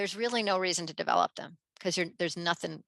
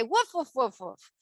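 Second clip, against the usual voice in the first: mock woofs, not a real bark; playful dog barks; 2 semitones higher than usual. Kids playing dogs, playful mock woofs. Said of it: "You have to say".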